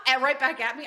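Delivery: usual speaking voice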